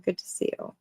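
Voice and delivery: soft unvoiced whisper. Real, not acted. Streamer greets a viewer again, whispering. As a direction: breathe the words, no voice.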